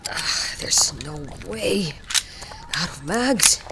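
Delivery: slow hushed whisper. Acted. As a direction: breathe it out under cover. Whisper under fire, out of ammo.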